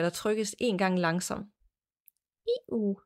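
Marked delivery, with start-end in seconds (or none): 2.5-3.0 whispered squeak of a squeeze toy; squeaky toy imitation. Shown as a demonstration. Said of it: "jeg skulle lige gengive det".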